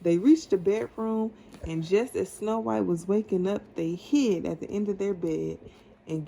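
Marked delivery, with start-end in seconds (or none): none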